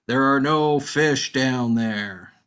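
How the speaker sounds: slow, loud voice rising from the hole; eerie voice from under the ice; mysterious voice. Acted. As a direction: boom it out slowly like an unseen voice.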